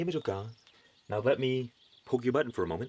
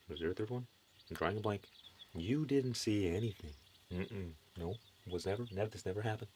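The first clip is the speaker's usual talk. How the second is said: dropped to a much softer conspiratorial murmur; hushed joking cover-up; 3 semitones lower, 8 dB quieter than usual. Pretend. Preacher pretending he never lost his third point, hushed. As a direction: hush it like you're hiding a mistake.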